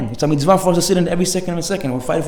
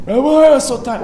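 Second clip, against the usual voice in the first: high, louder tired whine; mock whiny; 8 semitones higher, 4 dB louder, slower than usual. Friend excusing himself to the rabbi, mock whining. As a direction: whine like you can't move.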